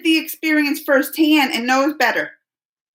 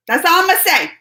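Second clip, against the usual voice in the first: louder and higher, pointed; emphatic repeat; 6 semitones higher, 4 dB louder, faster than usual. Real, not acted. Streamer to chat, repeating it louder and higher for emphasis. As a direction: say it out loud this time, louder and higher.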